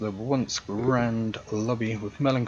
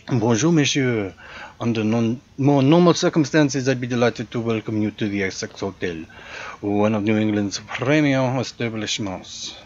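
louder, hammed-up French-European accent for a snooty concierge; hotel concierge greeting a guest, in a louder generic European accent; 6 dB louder than usual. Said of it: "an opportunity to do my generic European accent".

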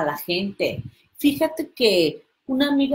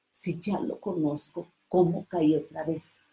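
self-reproach; 4 semitones lower, 7 dB quieter than usual. Retold or imitated. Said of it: "volteas y dices".

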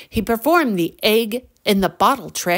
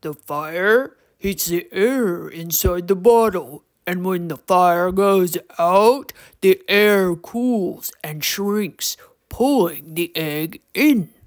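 gravelly troll explaining, slow; measured troll lecture; Torque; 3 semitones lower, slower than usual. A narrator's reading. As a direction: teach it slowly in a rough creature voice. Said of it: "deep and gravelly voice".

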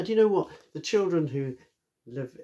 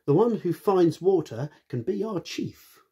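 animals calling out; 7 semitones higher, 5 dB louder than usual. Acted. Animals proposing a contest.

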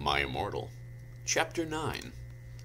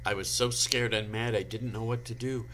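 usual speaking voice

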